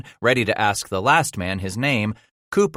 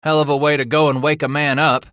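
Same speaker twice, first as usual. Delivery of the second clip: grumbling; 7 semitones higher, 5 dB louder than usual. A narrator's reading. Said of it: "Bass grumbled".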